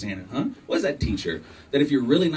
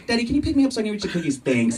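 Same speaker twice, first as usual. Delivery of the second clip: put-on rattled-off kid request; 7 semitones higher, much faster than usual. Daughter voiced, fast.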